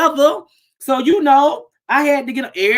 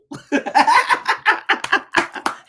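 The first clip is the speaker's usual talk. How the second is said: unvoiced giggling laugh; breathy laugh; 10 semitones higher than usual. Real, not acted. Laughing at own joke.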